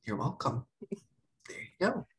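small, awkward whisper; whispered reply. Retold or imitated. Replying to tearful stepmom, awkward whispered comfort. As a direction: whisper it back meekly, as if unsure.

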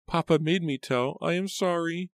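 put-on very high, frightened little-girl cry; Rachel, shrill with fear. A narrator's reading. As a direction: cry out an apology as a scared child. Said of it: "her voice shrill with fear".

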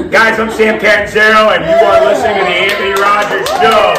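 loud announcer plug; loud, upbeat podcast announcer; show intro. Acted. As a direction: pitch the show like a host. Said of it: "show intro".

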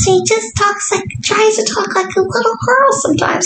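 put-on high little girl voice; man doing a woman's voice. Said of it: "he talks like this".

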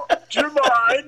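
cartoon Vader giggling at Luke; Goofy Vader. Shown as a demonstration. Goofy-ish Vader calling to Luke, laughing.

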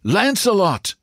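high-pitched cheering without extra volume; high, excited repeated cry; wildly excited. A narrator's reading. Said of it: "Wild with excitement".

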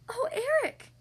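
high, breathless gasp of joy; a surprised, delighted cry. A narrator's reading. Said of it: "Ariel gasped".

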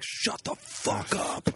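breathy, slow, mock-hushed comeback; mock whispered retort. Joking that the guest snapped back at his aunt in a slow whisper. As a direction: hiss it slowly under the breath.

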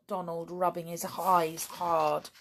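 plain reading voice

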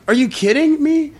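high-pitched retold disbelief; incredulous. Hearing from a friend of the birth, incredulous. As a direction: throw the question up high, stunned.

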